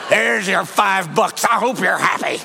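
slow mock-grumpy grudging handover; grudging giver. Preacher mimicking reluctant givers to the congregation, grudging. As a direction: mimic someone begrudging their gift.

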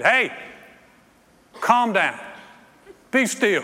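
short commanding shouts with pauses; acted rebuke. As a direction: call out firmly to still a storm. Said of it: "he, and the Bible said he rebuked".

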